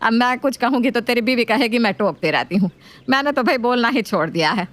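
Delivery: nagging mother-in-law voice. Acted.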